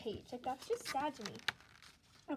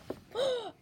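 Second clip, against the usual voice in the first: loud, high gasp of surprise; sudden real gasp; startled gasp; 11 semitones higher, 6 dB louder than usual. Real, not acted. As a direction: gasp sharply as if startled mid-sentence. Startled by falling into lampshade.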